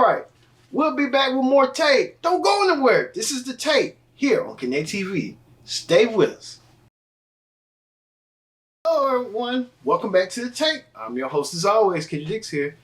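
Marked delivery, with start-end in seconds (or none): 0.7-6.6 high, upbeat announcer sign-off; show outro. Acted. Host telling viewers to stay tuned, upbeat announcer read. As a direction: pitch the break like a TV host.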